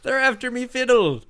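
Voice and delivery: put-on high old coot voice; old prospector. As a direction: whine like a grizzled miner. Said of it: "Just an old prospector shaking a stick".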